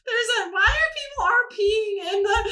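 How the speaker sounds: high, squeaky voice breaking into laughter; baffled, cracking up mid-question; laughing in disbelief. Real, not acted. Cracking up while reading reviews.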